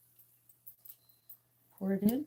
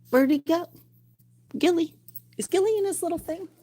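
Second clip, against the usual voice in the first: much higher, louder looking for him; anxious high-pitched search for fish; high worried calling; 9 semitones higher, 7 dB louder than usual. Real, not acted.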